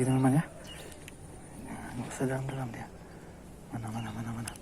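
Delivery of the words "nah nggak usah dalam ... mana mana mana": whispered, no voice; soft unvoiced whisper. Real, not acted. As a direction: whisper so the fish won't notice. Whispering near the fish.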